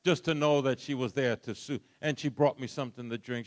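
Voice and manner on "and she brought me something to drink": normal talking voice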